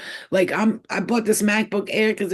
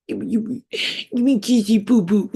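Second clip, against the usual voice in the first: put-on higher, louder silly baby talk; mock baby talk; 4 semitones higher, 3 dB louder than usual. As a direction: coo it like talking to a baby. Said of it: "I'll probably baby talk".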